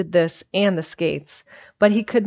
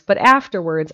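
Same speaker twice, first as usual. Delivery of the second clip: ordinary delivery, nothing marked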